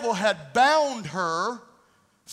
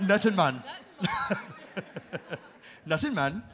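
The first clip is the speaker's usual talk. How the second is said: put-on "Nothing, man" said lightly as "nutting, mon" in a Jamaican accent; 4 semitones lower, 4 dB quieter than usual. Preacher joking to church, light Jamaican accent. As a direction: play it as a Jamaican, with a chuckle. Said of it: "That's how they say it in Jamaica".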